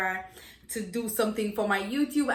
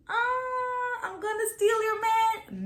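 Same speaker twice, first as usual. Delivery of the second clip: sassy taunt; 11 semitones higher, slower than usual. Acted. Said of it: "acted taunt".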